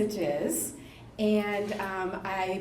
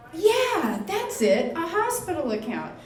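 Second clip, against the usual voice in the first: surfer caller; 6 semitones higher than usual. Retold or imitated. Surfer caller voiced in story.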